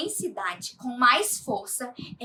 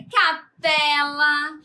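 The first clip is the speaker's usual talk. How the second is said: "capela" with PE stretched and louder; stress on PE; 5 dB louder, much slower than usual. Shown as a demonstration. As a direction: lean on the middle syllable of "capela". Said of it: "a entonação é mais forte". ("capela" com o PE mais forte e alongado)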